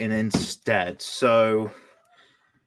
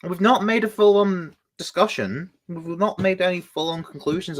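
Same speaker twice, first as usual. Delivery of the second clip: surprised; 6 semitones higher, 5 dB louder than usual. Real, not acted. Protesting the chat's go-go.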